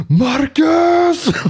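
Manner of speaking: very high, loud mock shriek; imitated mother's scream. Angry customer screaming after Marcus, imitated shriek. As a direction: screech the name like an outraged mother. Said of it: "screaming after Marcus in the background".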